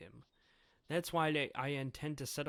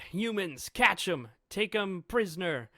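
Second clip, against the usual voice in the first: loud, high shouted orders; kids yelling; 5 semitones higher, 9 dB louder than usual. Acted. Giant kids chasing the humans, loud high yelling. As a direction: holler it like a gang of kids giving chase.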